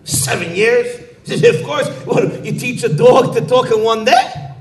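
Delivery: high, loud mock disbelief with laughter; incredulous scoff. Chaim Yankel scoffing at the overlord, high and laughing. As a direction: scoff at the idea it could be quick.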